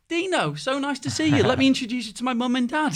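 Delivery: sweet as pie, rubbing it in with a grin; smug Savage. Retold or imitated. Savage cheekily greets rival.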